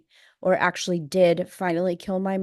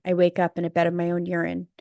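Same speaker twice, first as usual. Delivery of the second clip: ordinary delivery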